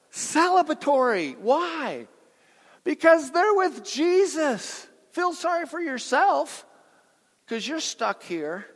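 high, loud celebratory preaching; preaching at full force. Acted. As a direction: lift the crowd, shout it out joyfully. Preacher shouts at a funeral sermon.

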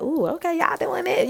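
high, light impressed voice; impressed reaction. Retold or imitated. Watcher to classmates who just floated, impressed. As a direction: go high on a soft, admiring ooh.

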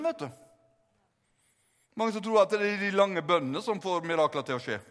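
ordinary talk, nothing marked

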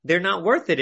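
normal talking voice

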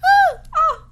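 high, loud excited yelps; excited exclamations. Real, not acted. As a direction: shout oh with sudden delight.

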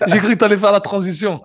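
amused, laughing while you speak. Real, not acted. Host teasing his guest, laughing.

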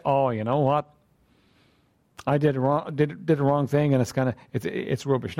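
lightly voiced reluctant sinner; mock sheepish admission. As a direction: mumble it like a half-hearted excuse. Mock sheepish confession of wrongdoing.

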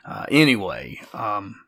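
ordinary delivery

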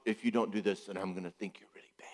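fast menacing whisper; shamer's whisper. Retold or imitated. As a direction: hiss the threat softly and fast. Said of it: "what shamers and scolders and punishers do".

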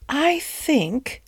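high, musing inner voice; thinking to herself. A narrator's reading. Duchess privately weighing up the pie, musing. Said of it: "thought the Duchess to herself".

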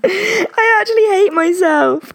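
laughing through embarrassment; half laughing, half groaning. Real, not acted.

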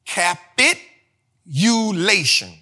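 drawn-out, syllable by syllable; Capitulation, spoken slowly and carefully. Shown as a demonstration. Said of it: "All right, say it slow".

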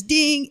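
high, ringing "ding"; elevator ding. Retold or imitated. Storyteller imitating the elevator's arrival, high ding. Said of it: "and he says".

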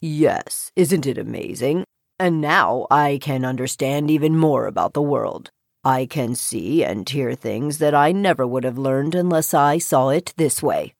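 low, calm frog voice; deep-voiced frog, quietly delighted; frog explaining. A narrator's reading. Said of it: "the frog explained".

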